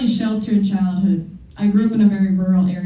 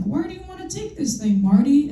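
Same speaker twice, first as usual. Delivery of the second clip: put-on high, excited old scientist voice; squeaky mad-professor pitch; imitating Doc; 7 semitones higher than usual. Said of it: "Doc's like".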